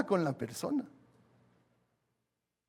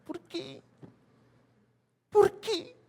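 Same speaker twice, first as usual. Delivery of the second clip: much higher, loud mock prayer wail, gradually softening; 7 dB louder, much slower than usual. Pastor mocks people who change their voice to pray, in a high mock prayer wail. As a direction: parody a churchgoer whose voice jumps way up when praying. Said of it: "no hay por qué cambiar el tono de voz".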